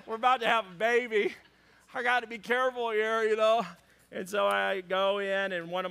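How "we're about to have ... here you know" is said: higher, louder, hurried protest; mock pleading excuse. Expectant father excusing himself to buddies, mock pleading. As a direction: rattle it off like a defensive father-to-be.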